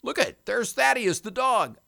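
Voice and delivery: high, eager little flea voice. Pretend. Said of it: "the flea says".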